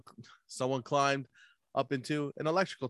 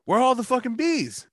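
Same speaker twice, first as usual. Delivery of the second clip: high mock outrage; 10 semitones higher, 4 dB louder than usual. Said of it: "he goes".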